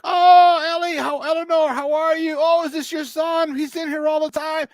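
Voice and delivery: high, excited ladies' greeting; high gushing welcome from the mom's friends at church; gushing church ladies. Retold or imitated. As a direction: coo it like delighted old church ladies. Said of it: "all the ladies like".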